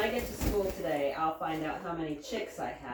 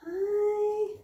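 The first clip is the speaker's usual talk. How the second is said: high, drawn-out hello; affectionate pet greeting; 12 semitones higher, 4 dB louder than usual. Real, not acted. Woman greeting her animal, high cooing.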